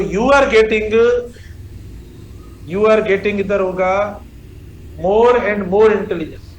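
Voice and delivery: slow, stretched words; slow dictation. Shown as a demonstration. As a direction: dictate for the class to copy. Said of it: "teacher dictating". (धीरे-धीरे लिखवाना)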